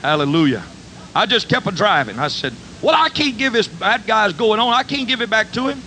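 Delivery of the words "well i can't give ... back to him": high, worked-up protest; protesting excitedly. Retold or imitated. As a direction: argue your case, pitched high. Preacher arguing with the box.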